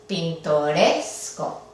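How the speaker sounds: pintoresco slow, leaning on the RES syllable; stress on RES. Shown as a demonstration. Teacher showing the stressed syllable, stress on RES. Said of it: "fonético es la penúltima".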